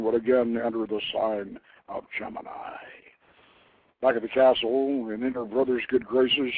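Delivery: unhurried dramatic reading of a legend; story narration. A narrator's reading. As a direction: tell it like a mysterious old tale. Reads story aloud.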